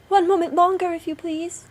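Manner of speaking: high call, loud at first; pleading wife. A narrator's reading. Wife stalls her angry husband.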